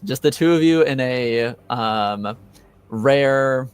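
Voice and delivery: ordinary talk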